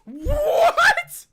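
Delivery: high, drawn-out yell of disbelief; shocked shout. Real, not acted. Stunned reaction to what was heard.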